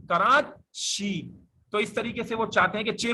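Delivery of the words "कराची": कराची drawn out slowly; very slow model reading. Shown as a demonstration. Teacher modelling कराची slowly to show the ch sound. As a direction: stretch कराची slowly, stressing ची. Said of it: "यूं कह रहे होते हैं".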